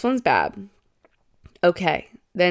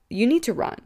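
ordinary talk, nothing marked

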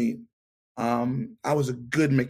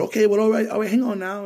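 much higher and louder stalling; flustered backpedal; 9 semitones higher, 6 dB louder, faster than usual. Retold or imitated. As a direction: scramble to buy time. Flustered reply to angry detailer.

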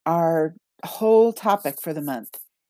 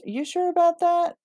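higher, teasing put-on voice for the doubting self; sly irrational self; 13 semitones higher than usual. Rational self taunted by her irrational side, teasing high voice. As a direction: needle her, sneaky and sing-song. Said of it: "her irrational self says".

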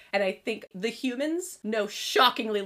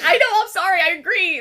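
giddy apology; 8 semitones higher, 9 dB louder than usual. Retold or imitated. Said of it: "I was like".

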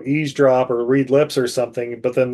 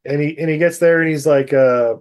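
normal talking voice

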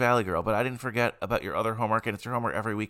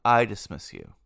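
slow, loud teacher halting the class; stern teacher; 5 semitones higher, 7 dB louder, slower than usual. Acted. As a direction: stop them like a strict teacher. Teacher stopping class at bell.

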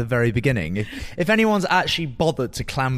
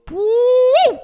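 high, loud whoop of delight; excited whoop; 6 dB louder than usual. Real, not acted. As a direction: cheer it out like a winner. Cheering own recipe.

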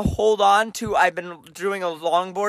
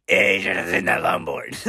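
very low, raspy smoker impersonation; exaggerated low smoker croak; 13 semitones lower than usual. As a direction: drop far down into a smoker's rasp. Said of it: "I talk like a smoker".

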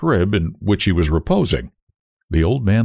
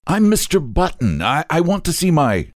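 higher-pitched, faltering introduction; nervous; 9 semitones higher than usual. A narrator's reading. Father addresses another nurse, nervously. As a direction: introduce yourself anxiously, stumbling on I.

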